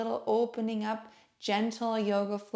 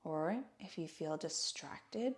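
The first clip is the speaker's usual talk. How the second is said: guided meditation; 2 semitones lower, 7 dB quieter than usual. Acted. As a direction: guide listeners gently into stillness, hushed and unhurried. Leads a seated breathing meditation.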